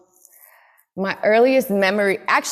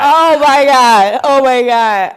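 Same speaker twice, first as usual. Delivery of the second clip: loud, drawn-out amazement; excited exclamation; 4 semitones higher, 10 dB louder, slower than usual. Real, not acted. Guest after shared excitement with host, loud amazement. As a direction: exclaim it loudly, overwhelmed.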